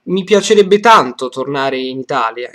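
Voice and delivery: loud "Mi piacerebbe tanto tornare in Italia", leaning on "tanto" and "Italia"; stress on TANTO, ITALIA. Shown as a demonstration. Teacher showing two sentence stresses, emphasis on "tanto" and "Italia". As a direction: give "tanto" and "Italia" the main beats. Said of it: "tanto and Italia are the main".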